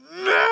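high mock wail of a four-year-old bursting into tears; child's wail. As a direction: wail like a little girl about to cry. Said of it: "she just looks up at me and goes".

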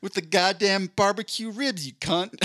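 lightly voiced Southern woman swearing at a waiter; Southern accent. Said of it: "I don't know why I made her Southern".

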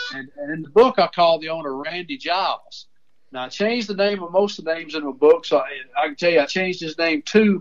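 usual speaking voice

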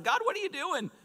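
very high-pitched mock exasperation; exasperated plea. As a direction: squeal the question up at heaven. Moses questions God in the story.

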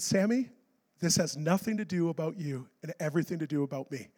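soft, low, measured reassurance; the Lord's voice. Acted. God reassuring the preacher mid-crisis, soft and low. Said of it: "here's what the Lord told me".

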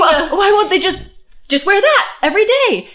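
high, loud, excited disbelief; astonished urging. Acted. Coach asking parents why not that outfit, astonished urging. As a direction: exclaim it as the glaringly obvious fix.

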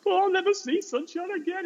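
very high mock wail; bawling. Pupils mourning the dead chicks, mock bawling. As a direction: imitate a heartbroken child bawling. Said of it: "they're all bawling".

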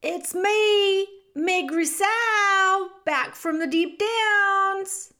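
high, loud, drawn-out holler; shouted. A narrator's reading. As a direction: call out slowly, stretching the words. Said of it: "she shouted".

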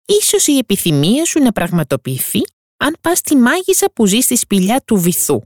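high, odd creature voice offering a secret; octopus voice. Acted. Octopus tells the mermaid about the witch, helpful.

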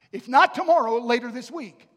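put-on a young minister sure the prophecy comes this week, eager; eager impatience. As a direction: insist it will happen right away. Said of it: "I thought".

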